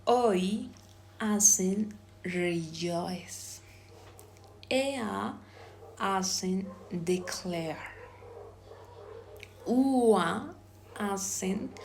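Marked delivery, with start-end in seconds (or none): 0.1-0.7 Oi as one drawn-out glide from o to i; isolated diphthong. Shown as a demonstration. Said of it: "I am going to pronounce". 4.7-5.3 Ea as one higher glide from e to a; isolated diphthong. Shown as a demonstration. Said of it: "I am going to pronounce". 9.7-10.5 Ua drawn out as a raised glide; isolated diphthong. Shown as a demonstration. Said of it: "I am going to pronounce".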